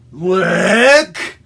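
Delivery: loud, drawn-out slurpy "lick"; exaggerated licking noise; imitated lick. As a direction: act out a big dog lick. Imitates dog licking the frog.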